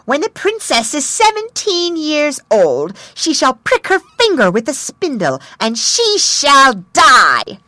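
put-on high, loud spiteful old fairy; spiteful curse. A narrator's reading. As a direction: spit out the curse, slowing at the end. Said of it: "Shaking her head spitefully".

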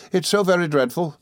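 high-pitched dismay; crying out. A narrator's reading. Dora dreads hearing that her fiancé is poor, crying out. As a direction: lift the voice high in girlish dismay. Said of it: "cried Dora".

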